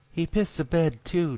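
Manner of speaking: a mother pointing out matter-of-factly; lightly voiced mother. A narrator's reading. Wife tells her husband about the bed, lightly voiced.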